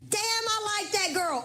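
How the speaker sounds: put-on high, slow, drawn-out "Damn, I like that girl" as an impressed viewer; admiring woman. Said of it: "you women can stand there and say".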